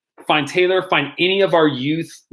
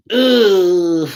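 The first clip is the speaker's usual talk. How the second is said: a pained groan, higher and louder; 5 semitones higher, 5 dB louder than usual. Real, not acted. Pastor stumped by the soup question, loud groan. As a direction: groan as if put on the spot.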